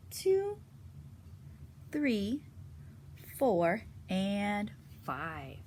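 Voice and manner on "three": ordinary delivery, nothing marked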